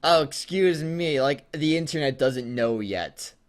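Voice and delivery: normal talking voice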